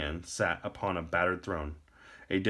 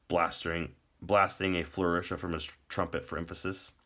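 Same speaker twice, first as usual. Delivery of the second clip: plain reading voice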